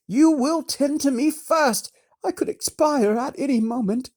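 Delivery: high, loud, sour complaining lady; haughty noble lady. A narrator's reading. Lady Ordith complaining to the wizard about her servant, haughty. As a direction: whine like a pampered aristocrat. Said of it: "a face that matched the voice".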